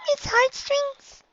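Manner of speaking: high little filly voice; small child pony, very high; high squeaky young filly. A narrator's reading. Narrator voices Dinky.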